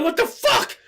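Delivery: high-pitched incredulous outburst; outraged disbelief. Retold or imitated. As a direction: exclaim it in reenacted outrage. Retelling outrage over missing money.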